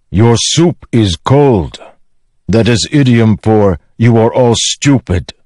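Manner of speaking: high, emphatic foreigner's voice, scornful; big-voiced foreign character scolding the others; Rock. Rock tells off the hesitant lowlanders, scornful.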